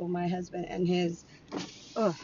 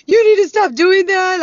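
much higher, louder strained voice; mock tearful plea; 10 semitones higher, 13 dB louder than usual. Ex-husband near tears begging her to stop, mock. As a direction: squeeze it out like he's about to cry. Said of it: "then he's like".

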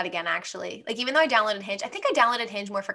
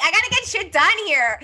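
higher, louder, no-nonsense push; louder bossy business voice; business mode; 10 semitones higher, 5 dB louder than usual. Shown as a demonstration. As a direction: get it done, firmly. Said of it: "let 'em know I'm talking business".

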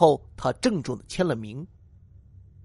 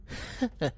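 a soft, falling little laugh; 4 semitones higher, 10 dB quieter than usual. A narrator's reading. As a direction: laugh fondly at him. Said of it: "小红笑着说". (轻轻地笑一声)

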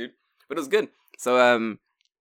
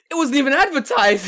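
excited outrage; 13 semitones higher, 9 dB louder than usual. Retold or imitated. Retold indignation.

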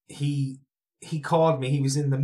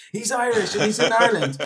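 imitating Pablo; 8 semitones higher, 4 dB louder than usual. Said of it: "he's like".

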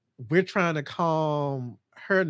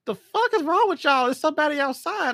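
exasperated outburst; 13 semitones higher than usual. Retold or imitated. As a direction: shout at them in disbelief. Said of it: "I'm like".

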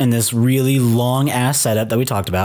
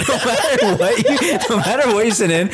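laughing; 8 semitones higher than usual. Real, not acted. Laughing echo.

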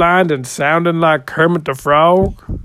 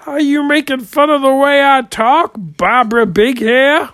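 high, loud indignant snap; offended; 11 semitones higher, 6 dB louder than usual. Real, not acted. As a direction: take offense, voice jumping high. Offended comic snapping at host.

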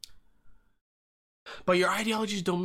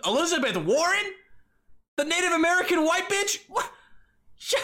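ordinary delivery

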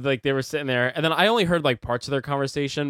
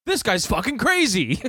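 high mocking old guy voice, raised; imitating the old man; 8 semitones higher, 3 dB louder than usual. Neighbor in the theater commenting on Joker, mocking high imitation. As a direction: lampoon the loud old man, pitched up. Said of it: "and he goes".

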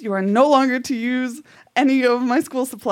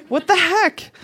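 higher exasperated what the heck; fed up with math, half playful; mock frustration; 7 semitones higher than usual.

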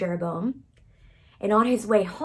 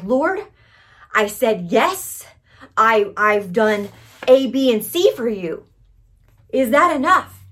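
loud, pleading, slowed plea to God; pleading cry; 2 semitones higher, 7 dB louder than usual. Retold or imitated. Weary believer crying out to God, loud and pleading. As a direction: cry out to the Lord, worn down and asking if it's enough.

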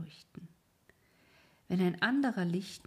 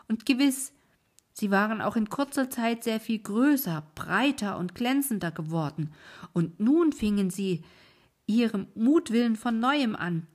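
put-on a higher, louder, indignant old woman; ranting about the will-o'-the-wisps, heated; indignant old wife; 3 semitones higher, 8 dB louder than usual. A narrator's reading. Wife rants to her husband about the intruders, heated and loud. Wife's long indignant outburst.

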